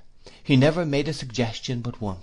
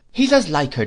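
higher and louder, hammering the point; emphatic; 6 semitones higher, 8 dB louder than usual. A narrator's reading. Aunt insisting to Dick, emphatic and louder. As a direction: insist on every word. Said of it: "said my aunt emphatically".

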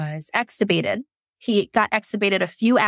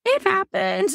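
high sing-out; high, lifted little aside; high-pitched; 12 semitones higher than usual. Real, not acted. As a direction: pitch it way up, brief and light. Repeats same words in high voice.

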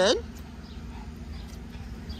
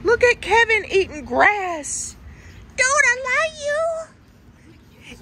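high, slow cooing; baby talk to a pet; 13 semitones higher, 4 dB louder, slower than usual. Acted. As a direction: coo sweetly to the dog. Cooing at the dog.

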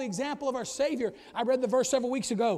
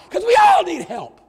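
preached shout; 9 semitones higher, 16 dB louder than usual. Acted. Shouted climax line.

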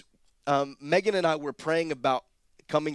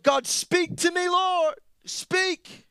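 very high, loud, drawn-out plea to heaven; acted-out desperate prayer, voice pitched way up; pleading cry; 6 dB louder, much slower than usual. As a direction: call out to God, begging for an answer. Acts out own pleading prayer to God.

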